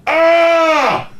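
sharp high yelp of pain; pained cry. Retold or imitated. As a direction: cry out as the jaw locks. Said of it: "I'm like".

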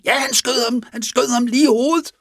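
high-pitched, frantic retold outburst; alarmed cry. Sailor reporting that the man was shot, alarmed cry. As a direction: cry it out in mock panic, voice leaping up. Sailor's panicked answer after shooting.

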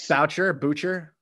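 "Boucher, Boucher" said first as BOW-cher, then BOO-cher; alternative pronunciations. Shown as a demonstration. Host checking a player's name, two pronunciations of "Boucher". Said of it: "Is it, is it".

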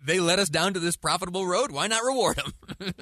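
laughing, playing along with the joke; sarcastic laughing. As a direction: chuckle through it, tongue in cheek. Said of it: "Okay, that's sarcasm".

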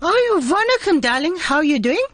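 man's high falsetto auntie; high, singsong gushing auntie; female auntie voice. Acted. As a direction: squeal it like a flattered auntie. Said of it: "from a male voice you change into a female voice".

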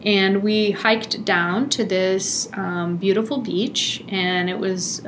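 usual speaking voice

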